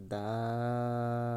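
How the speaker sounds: জিম held very long, three alif counts; long jim. Shown as a demonstration. Teacher modelling a three-count jim, drawn out. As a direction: stretch the vowel of জিম long and even. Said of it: "তিন আলিফ টান হবে".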